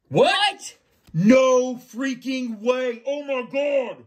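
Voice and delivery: high, loud stunned shout; shocked yelling. Real, not acted. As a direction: shout in disbelief at the card. Pulling a prized autograph card.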